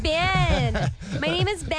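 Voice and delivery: put-on playful American-accented echo, drawn-out "Ben"; hammed-up American "Ben"; mock American accent. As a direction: copy the American-accented name teasingly. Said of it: "such a convincing American accent".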